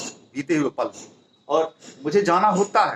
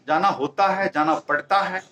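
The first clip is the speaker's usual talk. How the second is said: usual speaking voice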